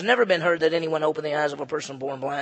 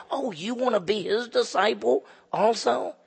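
higher-pitched mock-incredulous question; in-your-face taunt; 6 semitones higher than usual. Healed beggar going right in the leaders' face, taunting. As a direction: needle them with a pointed, lifted question. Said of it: "He really went right in the face".

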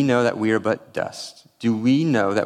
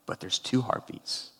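awed whisper; 10 dB quieter than usual. Retold or imitated. Awed reveal of twin heartbeats.

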